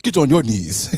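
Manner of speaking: put-on commanding Ugandan accent, breaking into laughter; mock Ugandan accent. Co-host mimicking the general ordering her to kneel, mock Ugandan accent. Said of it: "I don't know why I'm using that accent".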